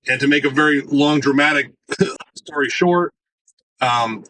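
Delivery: ordinary talk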